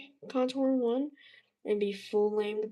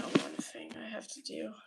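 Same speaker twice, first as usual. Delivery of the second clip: whispering; 5 dB quieter than usual. Real, not acted. Scheming aside to stream.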